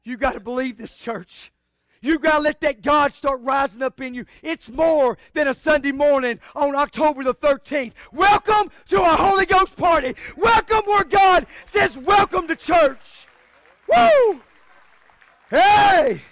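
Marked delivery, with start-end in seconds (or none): 2.1-13.3 shouted, high Holy Ghost welcome; full-force preaching. Real, not acted. 13.9-14.4 high shouted woo; ecstatic whoop. Real, not acted. 15.5-16.2 shouted, high Holy Ghost welcome; full-force preaching. Real, not acted.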